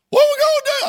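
high wailing mock cry; crying woman. Pastor retelling crying woman, high mock wail. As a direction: imitate the weeping woman's wail.

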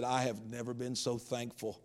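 hushed, moved telling of his thanks; retold weeping. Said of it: "And he started weeping".

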